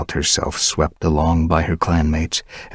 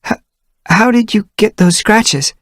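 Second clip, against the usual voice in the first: high, loud stammering question; flustered young she-cat; 7 dB louder than usual. Acted. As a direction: stumble to change the subject. Dovepaw flustered changing subject.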